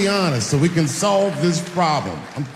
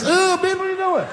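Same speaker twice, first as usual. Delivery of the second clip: much higher, half-shouted disbelief; mock shocked outrage. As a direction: squeal it like you can't believe her nerve. Own shocked reaction retold.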